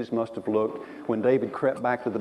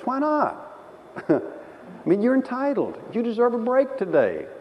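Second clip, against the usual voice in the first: higher, coaxing tempter; old man of the flesh; 9 semitones higher, 6 dB louder than usual. Retold or imitated. Preacher voicing the flesh tempting, higher. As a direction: wheedle like a temptation. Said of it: "the old man of the flesh says".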